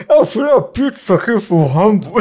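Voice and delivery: mock garbled intercom voice, high-pitched; imitação de interfone. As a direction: mimic an unintelligible intercom, words smeared together. (imitação de interfone chiado, aguda e embolada)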